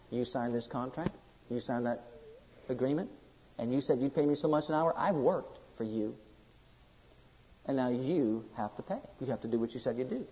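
low, soft, measured demand; quiet firm insistence. Acted. Worker holding employer to the signed contract, quiet firm insistence. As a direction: lay down the terms quietly and firmly.

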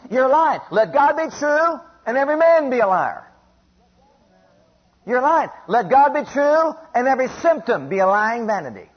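loud, high preaching cry; forceful rebuke. Acted. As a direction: shout the devil down with ringing conviction. Rebuking the devil's doubts.

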